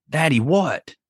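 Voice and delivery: lightly voiced small daughter calling; father imitating his daughter answering a voice; child's voice. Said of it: "she starts doing it again".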